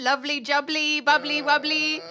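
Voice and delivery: singsong lovely jubbly, bubbly wubbly; Jamie Oliver catchphrase. Retold or imitated. Hamming up the chef bit for husband, playful. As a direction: relish the silly rhyme like a TV chef. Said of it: "my terrible Jamie Oliver impression".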